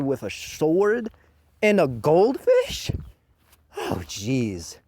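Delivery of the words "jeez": ordinary delivery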